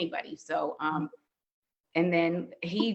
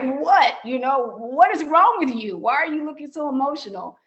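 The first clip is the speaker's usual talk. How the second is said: alarmed asking; 6 semitones higher, 4 dB louder than usual. Retold or imitated. Asking why boyfriend looks tearful.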